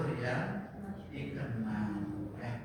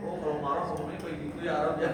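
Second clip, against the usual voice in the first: laughing mock realization; faster than usual. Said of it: "imitating the student realizing".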